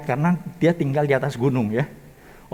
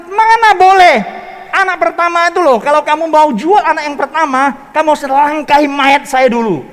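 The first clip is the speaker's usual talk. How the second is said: much higher, louder furious refusal; hammed-up enraged husband shouting at wife; angry husband; 11 semitones higher, 10 dB louder than usual. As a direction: roar it like a father defending his son. Husband angrily refuses selling child.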